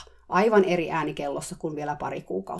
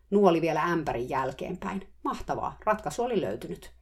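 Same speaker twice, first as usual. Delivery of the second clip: normal talking voice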